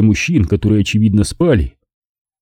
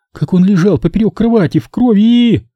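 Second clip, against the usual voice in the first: Nina upset; 10 semitones higher than usual. A narrator's reading. Nina's voice continues.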